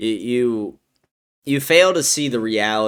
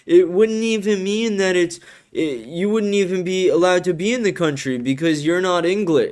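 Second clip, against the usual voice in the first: whiny mock commenter; 10 semitones higher, 5 dB louder than usual. Streamer mocks a commenter's argument in a high, whiny mock voice. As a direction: sneer it out like a whiny kid. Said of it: "And you're like".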